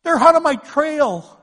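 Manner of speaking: high, loud desperate cry to God. Acted. David, chased by enemies, crying out to the Lord. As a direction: call out to the Lord as a hunted man. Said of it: "a guy that's crying out".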